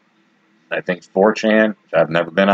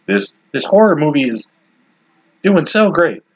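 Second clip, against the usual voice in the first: news anchor voice; 8 semitones higher than usual. Retold or imitated. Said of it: "They said".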